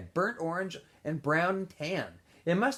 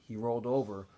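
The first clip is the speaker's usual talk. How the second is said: plain reading voice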